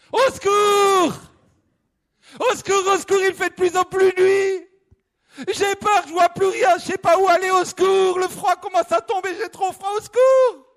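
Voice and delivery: high, loud frightened yelling; terrified cries. Acted. The hiker alone in the dark forest screaming in fear. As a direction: panic rising, shout into the cold night. Hiker shouts, afraid, cold.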